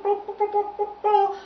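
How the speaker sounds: loud, high trumpet "bo-bo" notes; imitated trumpet. Trumpet taking its turn, loud tooting. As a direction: toot the syllables out brassily. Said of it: "said the trumpet".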